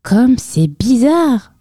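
high, slow wondering mouse; astonished mouse. A narrator's reading. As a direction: marvel at it, stretched out and high. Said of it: "s'étonna la souris".

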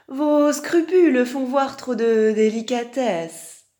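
high, fawning courtier voice with swooping emphasis; flattering fox. Acted. Fox excusing the lion king's sins, fawning. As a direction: flatter grandly, stretching the key words.

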